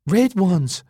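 high, loud delighted cry; excited. A narrator's reading. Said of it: "she said excitedly".

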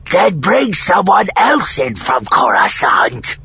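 loud, high, slow alien demand; forceful, growly insistence. A narrator's reading. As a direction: demand it in a rough creature voice. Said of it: "the Noghri demanded".

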